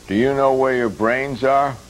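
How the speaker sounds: hammed-up news announcer; mock TV announcer. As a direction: deliver it like a late-night station promo. Parodying a TV station slogan.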